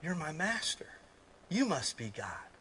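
lightly voiced grateful dog; quiet adoring dog; devoted dog voice. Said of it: "a dog will say".

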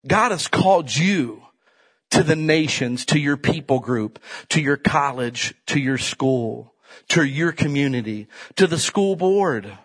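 slow, measured sermon cadence; slow preaching list. Acted. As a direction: lay each place down deliberately.

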